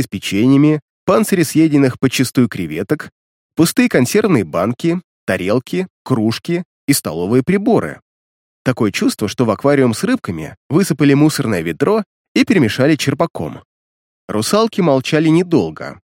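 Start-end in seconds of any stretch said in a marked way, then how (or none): none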